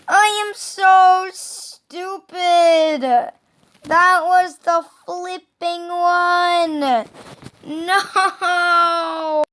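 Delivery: loud, high, slow self-scolding wail; despairing yell. Real, not acted. Gamer misses the winning jump, despairing yell. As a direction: drag the words out in frustrated anguish.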